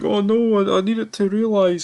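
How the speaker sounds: high squeaky panicked voice, imitating her; mock panicked woman. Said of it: "and she's like".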